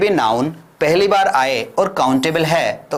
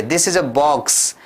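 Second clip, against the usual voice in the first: slow, clear classroom drill; slow model sentence; much slower than usual. Shown as a demonstration. Teacher drilling simple sentences slowly for learners. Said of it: "हमारे पीछे पीछे repeat कर दीजिए".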